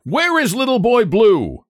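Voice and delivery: high-pitched, cross questioning; angry demand. A narrator's reading. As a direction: demand it as an irate squire. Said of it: "made him more angry still".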